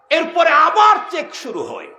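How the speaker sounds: high, loud proclamation; ringing pulpit cry; preacher's shout. Real, not acted. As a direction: announce it forcefully to the crowd. Preacher proclaims the next check.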